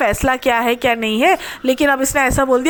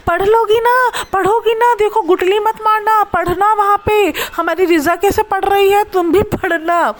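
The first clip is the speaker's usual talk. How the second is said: high, sing-song lecturing voice; mock preachy aunt; 7 semitones higher than usual. Vlogger mimics the aunt lecturing Mehar to study, high preachy mock. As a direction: sweetly nag like a fussy aunt.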